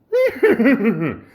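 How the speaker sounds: high, loud mock horse whinny laugh. Vlogger joking his voice could sound like a horse, loud whinny. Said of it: "I could sound like a horse".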